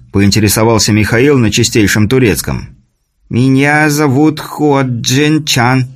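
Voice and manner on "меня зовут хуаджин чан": put-on slow Chinese accent, broken words; alien learning english. A narrator's reading. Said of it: "с характерным мягким акцентом и коверкая слова".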